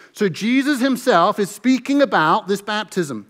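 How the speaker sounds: ordinary talk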